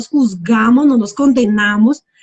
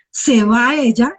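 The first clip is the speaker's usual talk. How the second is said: usual speaking voice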